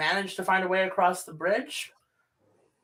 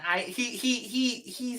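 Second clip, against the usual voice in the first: stammering; 7 semitones higher than usual. Acted. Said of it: "he stammers".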